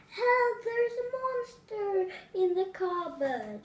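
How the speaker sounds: Daisy frightened by a monster in the cupboard; scared cry. Acted. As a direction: yelp for help in fright. Danny scares, Daisy cries out.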